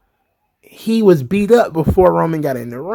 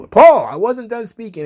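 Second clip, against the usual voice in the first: insistent mock objection; 5 semitones higher than usual. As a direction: cut in, imitating someone fed up with interruptions. Repeats objection after being ignored.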